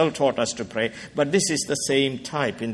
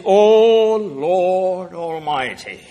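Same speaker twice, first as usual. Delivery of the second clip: slow, louder reverent invocation; solemn prayer; 4 semitones higher, 6 dB louder, much slower than usual. Acted. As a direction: call on God slowly and reverently. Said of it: "Verse sixteen".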